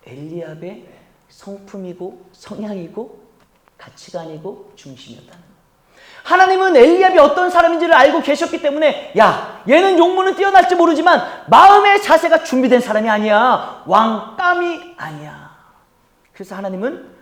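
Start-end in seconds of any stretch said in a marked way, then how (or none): 6.2-15.5 high, loud pulpit proclamation easing at the end; forceful preaching. Acted. Preacher voicing God's rejection of Eliab, forceful proclamation. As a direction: cry out the verdict, then settle.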